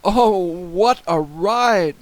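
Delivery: high, loud and drawn out; excited exclamation. A narrator's reading. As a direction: stretch it out like a wild ride. Boy exclaims over wild ride.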